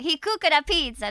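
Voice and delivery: quick, loud mock Italian; Italian accent. Proving the Italian accent is already mastered, loud mock Italian. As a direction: toss out a hammed-up Italian accent. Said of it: "I can already do the Italian".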